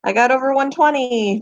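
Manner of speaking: normal talking voice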